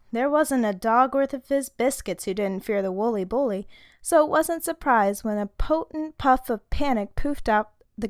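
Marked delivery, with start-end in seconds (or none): none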